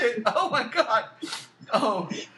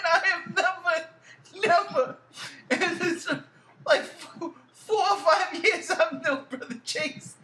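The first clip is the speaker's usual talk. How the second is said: laughing while talking; 5 semitones higher than usual. Real, not acted. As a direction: talk while helplessly laughing. Host cracking up after rant.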